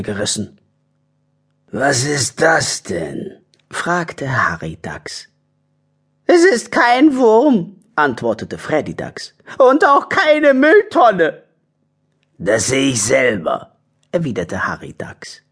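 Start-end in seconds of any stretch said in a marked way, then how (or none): 1.7-3.4 slow, altered character voice; badger character voice. Harry the badger inspects a snatched card, slow character voice. 6.3-7.8 slow, high, loud character voice; high badger voice. Freddy the badger answers Harry, high badger voice. 9.6-11.4 slow, high, loud character voice; high badger voice. Freddy the badger answers Harry, high badger voice. 12.4-13.8 badger character voice. Lead badger retorts in story.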